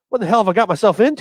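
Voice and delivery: high, hurried mock panic; retold nervous panic. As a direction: rush it out as if retelling your own nerves. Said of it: "I'm sitting back there shaking like".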